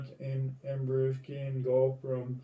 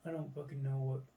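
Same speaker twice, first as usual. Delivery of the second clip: usual speaking voice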